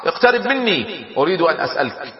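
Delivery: high-pitched call across to the enemy. A narrator's reading. Roman commander hails Khalid to approach, calling out high. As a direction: raise your voice high and hail him. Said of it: "وبدأ ينادي باللغة العربية".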